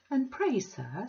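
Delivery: put-on high, genteel old lady; prim Mrs Sparsit. Mrs Sparsit primly questioning Bounderby, high and genteel. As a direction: lift it into a prissy, mannered voice.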